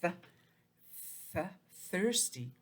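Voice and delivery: "thirsty" slow, the th hissed out clearly before the word; th sound shown. Shown as a demonstration. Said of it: "Remember the T H says th".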